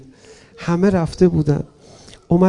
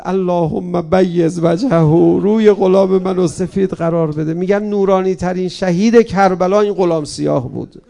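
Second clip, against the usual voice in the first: preaching prayer; 7 dB louder than usual. Acted.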